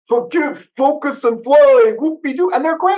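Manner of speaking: mocking their slogan. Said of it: "they're like, you know".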